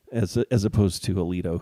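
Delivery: normal talking voice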